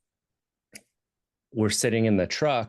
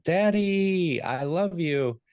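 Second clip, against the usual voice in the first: put-on high, bright child's call, much higher than his own voice; kids yelling; 10 semitones higher, much slower than usual. Kids running inside yell to their dad, imitated high child voice. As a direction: mimic a little kid calling out to dad. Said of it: "they come running inside and they yell".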